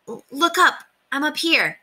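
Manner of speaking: high-pitched call to someone far below; high, careful call; calling down carefully. A narrator's reading. Small-voiced boy calling down to her.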